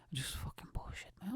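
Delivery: very soft self-mocking whisper; hushed mutter to yourself; whispered aside. Real, not acted. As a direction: whisper it under your breath. Muttered aside on his own show.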